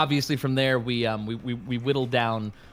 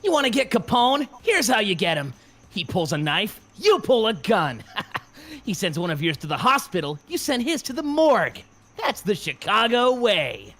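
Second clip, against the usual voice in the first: gruff high mobster growl; tough-guy movie quote delivery; Rocky's voice; 10 semitones higher, 5 dB louder than usual. Shown as a demonstration. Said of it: "here's a congested attempt at Rocky's voice".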